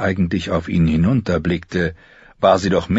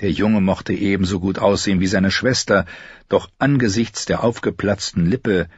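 ordinary delivery, nothing marked

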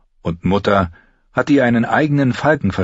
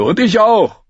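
put-on high, louder whine of a hungry boy; whining; 6 dB louder than usual. A narrator's reading. Heiße Pastete whines to Gendry about hunger. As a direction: whimper it like a complaining child. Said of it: "jammerte Heiße Pastete".

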